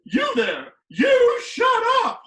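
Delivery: high, slow bellowing yell; teacher screaming across the room; furious shout. Retold or imitated. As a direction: roar it at the whole class. Teacher yelling at boy.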